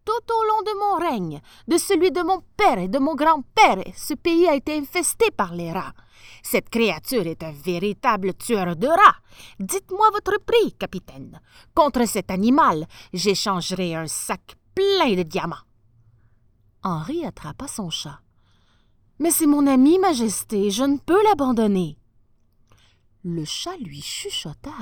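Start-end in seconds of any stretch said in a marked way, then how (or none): 0.0-15.7 high, loud voice of a keen sultan; a grand ruler desperate to buy the rat-killer; lofty sultan voice, raised and eager. Acted. Sultan bargaining for the cat. 19.2-22.0 put-on louder, earnest voice of a boy refusing; a lad protecting his friend; lightly voiced youth, urgent and loud; pleading young sailor. Young Henri refusing the sultan.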